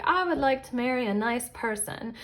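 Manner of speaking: dreamy romantic wish. Retold or imitated. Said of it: "sorry, I'm thinking of romance".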